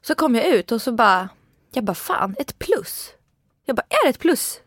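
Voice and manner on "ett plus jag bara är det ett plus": shocked. Retold or imitated. Shocked at pregnancy test.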